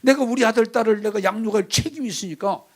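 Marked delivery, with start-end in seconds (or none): none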